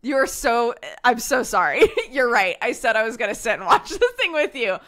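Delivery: loud laughing sorry; laughing apology. Retold or imitated. Apologizing to partner for leaving, laughing. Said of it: "I'm like".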